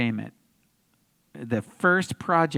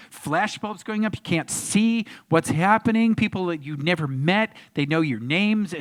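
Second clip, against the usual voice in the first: ordinary talk, nothing marked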